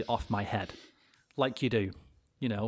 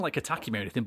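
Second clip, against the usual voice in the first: usual speaking voice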